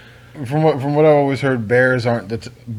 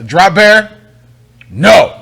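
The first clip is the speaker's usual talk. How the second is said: scolding a bear; 6 semitones higher, 8 dB louder, much slower than usual. Retold or imitated. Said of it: "you gotta tell".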